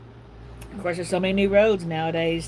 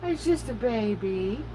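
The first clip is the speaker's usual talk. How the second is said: cooing to dog; 7 semitones higher than usual. Real, not acted.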